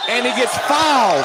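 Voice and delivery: sudden jump up in pitch and volume; excited call. Real, not acted. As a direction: call the foul with a burst. Play-by-play foul call.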